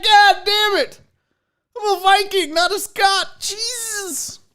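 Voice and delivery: high, loud cry of dismay; mock exasperation. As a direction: play the friend's outraged disbelief. Buddy's dismay at result, acted.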